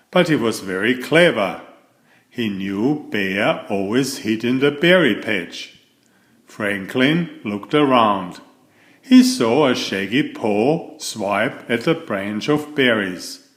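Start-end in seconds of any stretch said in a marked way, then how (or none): none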